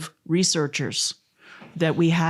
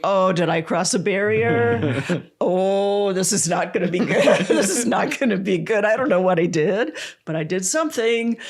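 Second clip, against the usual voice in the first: nervous panic; 4 semitones higher than usual. Retold or imitated. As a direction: act out the jittery worry with laughs breaking in. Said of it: "I'm looking around going".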